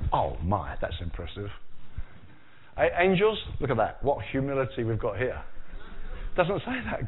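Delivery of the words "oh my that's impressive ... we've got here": mock impressed God. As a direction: pretend God admiring false humility, deep and hushed. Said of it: "God doesn't say".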